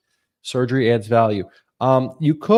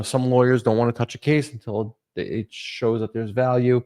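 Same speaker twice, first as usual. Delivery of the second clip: ordinary talk, nothing marked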